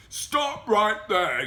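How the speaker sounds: high, drawn-out yell; granny yelling. A narrator's reading. Granny bursting in on the wolf, high yell. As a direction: yell it slowly as a command. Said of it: "yelled Granny".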